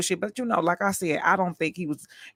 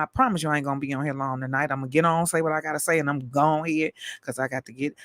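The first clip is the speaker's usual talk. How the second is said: ordinary talk, nothing marked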